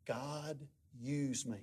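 very soft, low, halting words; hushed, reverent imitation; weak dying plea. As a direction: imitate a failing man's last words. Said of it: "he was just shaking".

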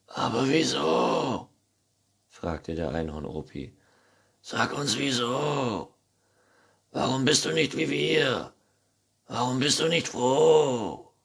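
put-on puzzled old grandpa unicorn. A narrator's reading. (Einhorn-Opa, bittend)

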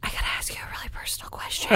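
hushed, hurried confidential whisper; fully whispered mock-confidential question; retold whisper. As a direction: lean in and whisper it fast, imitating a nosy stranger.

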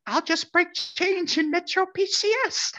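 slow, very high falsetto; imitated street vendor. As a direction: draw it out as a squeaky cartoon lady. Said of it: "she turns and looks".

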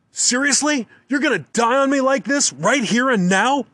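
high, loud hollering disbelief, slowing at the end. A narrator's reading. Hunter hollering at the bear that is dying too soon. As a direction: yell it up high, outraged. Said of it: "I holler".

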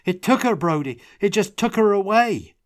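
high, loud anguished outcry; distraught. A narrator's reading. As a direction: cry out in shock and grief. Tomas distraught after the attack.

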